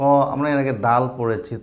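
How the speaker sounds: ordinary talk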